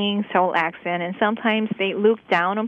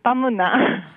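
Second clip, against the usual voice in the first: quick Busan-accent question in her usual pitch; much faster than usual. Shown as a demonstration. Local answering Seoul version with her own, Busan accent. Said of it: "But we say".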